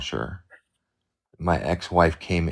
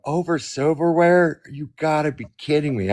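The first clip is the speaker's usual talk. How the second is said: incredulous; 8 semitones higher than usual. Retold or imitated. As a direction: stretch it out, stunned by something so petty. Retold incredulous reply to partner.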